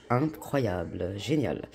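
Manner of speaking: sarcastic. Real, not acted. Varnish smudged everywhere again.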